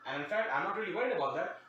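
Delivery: ordinary talk, nothing marked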